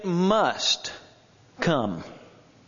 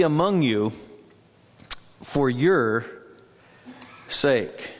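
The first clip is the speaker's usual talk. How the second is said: scripture reading; 3 semitones higher than usual. Acted. Congregation reads verse five.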